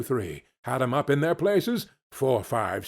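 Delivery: plain reading voice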